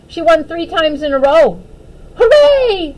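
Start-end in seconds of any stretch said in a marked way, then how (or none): 2.1-2.9 loud high hooray; cheering. Acted. Opal winning at Go Fish, loud high cheer. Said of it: "she cheered".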